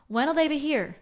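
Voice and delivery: "will" reduced to "'l" after "when"; natural question. Shown as a demonstration. Teacher showing her own everyday way of asking. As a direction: run "when" and "will" together. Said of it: "how I would say it".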